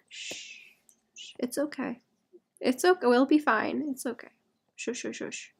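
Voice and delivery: soft, hushed self-soothing; calm yourself down gently; mock soothing shush. Hushing herself and chat after the stream glitch, softly. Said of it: "soothing herself and chat".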